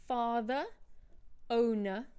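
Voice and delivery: Southern British "father, owner" with silent final R; non-rhotic word endings. Shown as a demonstration. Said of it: "we do not pronounce the R".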